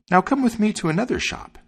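high, eager bird character; high-pitched, encouraging little bird; sparrow voice. A narrator's reading. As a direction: pipe up high as a helpful sparrow. Sparrow leading the dog on.

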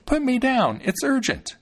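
high little-fellow voice, pressing; urgent tiny boy. A narrator's reading. Thumbling urgently demands to get down.

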